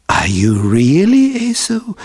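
loud question climbing high; suspicious father. Acted. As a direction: draw out the doubt, rising sharply in pitch.

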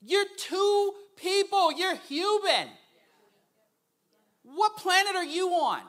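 incredulous shout. Real, not acted. Preacher exclaims at unrealistic expectations.

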